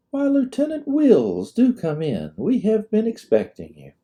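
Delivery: high, sweet southern hostess welcome; high society hostess voice. A narrator's reading. Hostess greeting the arriving lieutenant, high and gracious. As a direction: gush a gracious welcome at the door.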